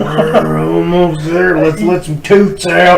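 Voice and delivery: slow loud strained butt voice; drawn-out straining plea; talking butthole. Pretend. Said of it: "your butthole's just like".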